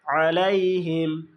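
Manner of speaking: "আলাইহিম" slow, opening from the squeezed throat; correct throat ain. Shown as a demonstration. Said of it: "আইনের উচ্চারণ আ". ("আলাইহিম" কণ্ঠের আইন দিয়ে ধীরে)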